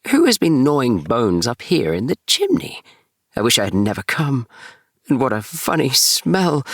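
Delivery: a nervous little kitten sniffing the dark; Tom Kitten. Acted. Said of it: "said Tom Kitten".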